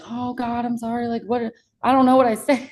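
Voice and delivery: retold helpless apologizing; flustered apology. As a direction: stumble through an acted apology. Acts flustered dad apologizing.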